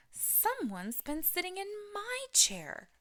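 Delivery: high, slow mother bear voice; Mama Bear crying out. A narrator's reading. Mama Bear finds her chair sat in, high and slow. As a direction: lift high and draw it out in alarm. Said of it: "cried Mama Bear".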